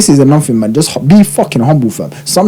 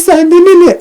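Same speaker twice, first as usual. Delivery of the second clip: high mocking taunt. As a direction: sneer it up high like the guy. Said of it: "He's like".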